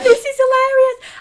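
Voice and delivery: high, loud giggling line; laughing quote. Retold or imitated. Telling George the bird poo is hilarious, laughing. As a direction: squeal it through laughter. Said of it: "I was like".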